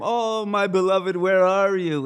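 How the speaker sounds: high, hammed-up wail; mock crying. Rama searches for his lost beloved, mock crying. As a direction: call out in theatrical grief. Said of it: "he goes back to crying".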